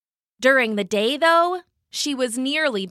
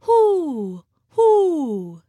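tidy owl snore hoo; 6 semitones higher, 4 dB louder than usual. A narrator's reading. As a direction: hoot gently in her sleep. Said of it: "snoring with a tidy little".

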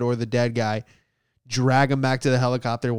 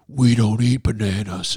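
lightly voiced talking ape, a bit lower; Caesar the ape; 3 semitones lower than usual. Comic voicing the ape leader Caesar, lightly lower. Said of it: "talking like Caesar's like".

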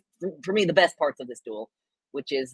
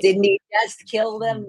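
mocking voice; 6 semitones higher, 6 dB louder, slower than usual. Mocking internet critics.

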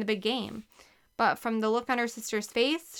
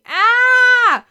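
much higher, loud sudden cry; startled yelp; 16 dB louder than usual. A narrator's reading. Girl slipping on the stairs, sudden yelp. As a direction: yelp as she slips. Missing her footing on stairs.

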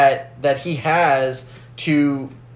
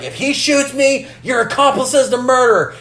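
higher-pitched mock sheriff; lightly acted sheriff's warning, pitched up; sheriff's threat; 8 semitones higher than usual. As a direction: quote the sheriff in a raised voice. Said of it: "the sheriff's like".

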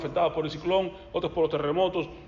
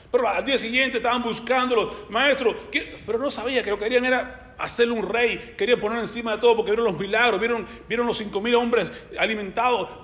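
fervent preaching; 6 semitones higher, 5 dB louder than usual. Real, not acted. Preacher rises to full force.

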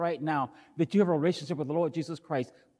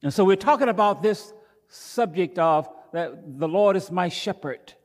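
ordinary talk

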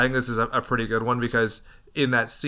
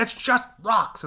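high, drawn-out retold cry; mock protest; 11 semitones higher, slower than usual. Man emptying the coffin of rocks, mock high outcry. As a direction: stretch it out high, imitating his outcry.

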